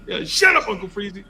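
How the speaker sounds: high, loud mock snap; mock teacher snapping; snappy teacher. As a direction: snap at the kid. Annoyed teacher voiced.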